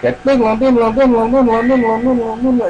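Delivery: high sung chime effect ding-long; imitating a chime. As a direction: chant a high repeated chime sound. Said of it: "I love that transformation sound effect".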